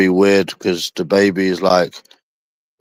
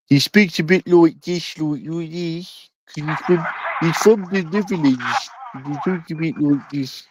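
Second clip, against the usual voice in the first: put-on Dutch accent; 5 semitones higher, 3 dB quieter than usual. Imitating how Pepe from the Netherlands talks, mock Dutch accent. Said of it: "What kind of accent is that?".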